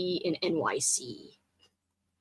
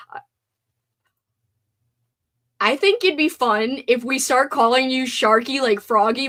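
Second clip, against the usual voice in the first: usual speaking voice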